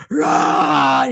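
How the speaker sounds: loud, acted-out shout; imitated roar. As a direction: burst out like he's turning monstrous. Acting out his shouted warning.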